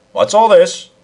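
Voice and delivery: a hint of a constable demanding answers; stern policeman. A narrator's reading. Policeman to the two men, a lightly voiced demand.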